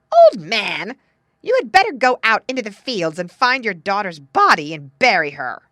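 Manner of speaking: very loud, harsh command; wicked stepmother. A narrator's reading. Wicked stepmother ordering her husband, loud and harsh. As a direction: order him about at full volume.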